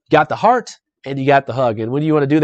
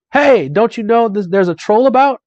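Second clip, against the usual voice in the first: loud, high-pitched halfling yell; acted tavern-drunk's yell; 8 semitones higher, 5 dB louder than usual. A narrator's reading. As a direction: call out a warning, raised and high. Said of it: "One yells".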